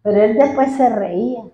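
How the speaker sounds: ordinary talk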